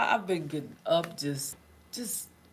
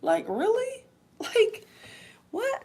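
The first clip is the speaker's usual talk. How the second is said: high, squeaky really and what; high-pitched amused disbelief; slower than usual. Real, not acted. Disbelief at weather.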